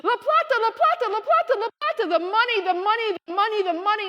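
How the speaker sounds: high, loud panicked shrieks; frantic screaming. Retold or imitated. Host mom running down the hill over the burning money, panicked high scream. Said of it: "come running down the hill screaming".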